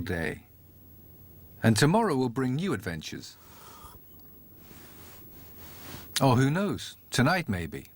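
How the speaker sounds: sleepy bedtime musing. Acted. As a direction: settle in for sleep, musing aloud. Bedtime intro before the story.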